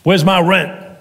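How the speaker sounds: loud demanding landlord; cold greedy landlord. Acted. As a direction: bark the rent demand. Landlord demanding rent again.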